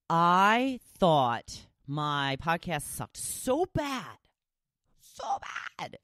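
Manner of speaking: exaggerated "so bad, so bad"; emphatic. Real, not acted. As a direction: stretch it out, then squeak the second "so". Emphasizing how bad the podcast was.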